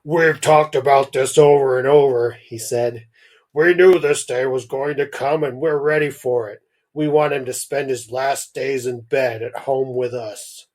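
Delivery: steady, resolved grieving dad; Tim's dad. Acted. Dad telling the doctor they're ready, firm and loud. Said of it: "How did Tim's dad say?".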